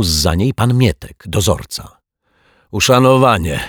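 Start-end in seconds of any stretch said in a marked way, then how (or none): 2.7-3.6 winded caretaker, louder and higher; a touch of breathless effort, raised voice; puffing greeting. A narrator's reading. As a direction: puff it out while climbing stairs. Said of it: "zasapał".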